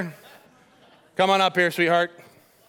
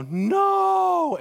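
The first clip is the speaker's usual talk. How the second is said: demo anguished no; 12 semitones higher than usual. Shown as a demonstration. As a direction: show her how to wail it. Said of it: "And you go".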